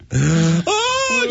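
high-pitched mock scream; sudden high shriek, held back in volume. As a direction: imitate a startled woman shrieking.

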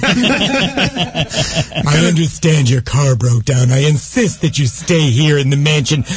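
put-on slow, silky old horror host; calm Vincent Price voice. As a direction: soothe the stranded guest, slowly. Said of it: "he has the calm voice".

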